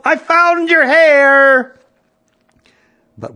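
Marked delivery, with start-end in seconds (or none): 0.0-1.7 high, loud, drawn-out holler; yelling. A narrator's reading. Mother calling out that she found it, yelling. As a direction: holler the news at full voice. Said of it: "she yelled".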